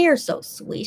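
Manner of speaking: usual speaking voice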